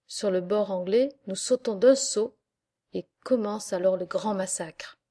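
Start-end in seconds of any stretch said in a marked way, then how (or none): none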